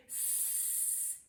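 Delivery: S as a soft unvoiced hiss; hissed S sound. Shown as a demonstration. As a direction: hiss "S" quietly with no voice. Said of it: "do the rising sun and say".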